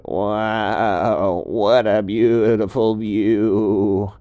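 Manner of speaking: both marvel at the summit view; awed. Acted. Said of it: "they both said".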